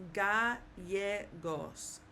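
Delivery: "gallegos" broken slowly into ga, YE, gos; slow, separated Spanish "gallegos" with a Y sound; correct Spanish Gallegos. Shown as a demonstration. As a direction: say "gallegos" syllable by syllable, double L as Y. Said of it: "double L is gonna always sound like a Y".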